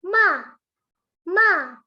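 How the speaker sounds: "ma" falling sharply from high, loud; fourth tone. Shown as a demonstration. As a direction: drop "ma" hard like a command. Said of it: "nada keempat".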